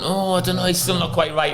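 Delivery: high, fretful imitation of a physio; mock panicking. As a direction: dither nervously under the manager's pressure. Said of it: "just panicking".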